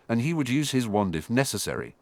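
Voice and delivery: ordinary delivery, nothing marked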